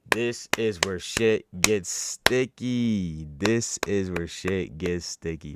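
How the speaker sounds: loud, drawn-out hype announcement; slow, punched-up story teaser; dramatic build-up. Real, not acted. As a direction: stretch every word out loud for suspense. Hyping the turn in his story.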